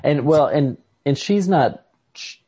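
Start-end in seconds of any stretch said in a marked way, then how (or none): none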